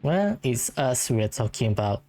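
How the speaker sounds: ordinary delivery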